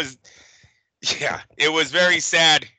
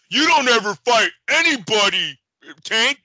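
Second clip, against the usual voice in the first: lightly mimicked promoter, pitched higher; mock heckling promoter; 5 semitones higher than usual. Promoter calling past his own fighter to Tank, mock heckling. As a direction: put on the promoter's taunt at a fighter. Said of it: "looking past Frank Martin going".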